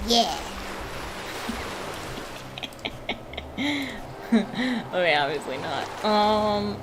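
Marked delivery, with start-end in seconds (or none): 0.4-4.3 quiet, breathy laugh. Real, not acted. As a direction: chuckle softly at your own joke. Amused at silly word combination.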